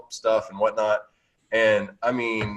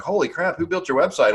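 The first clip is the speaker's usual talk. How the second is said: higher-pitched mock astonishment; quoting someone wowed, pitched up; imitated amazement; 6 semitones higher than usual. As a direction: act out an impressed visitor's reaction. Acting out amazed site visitors.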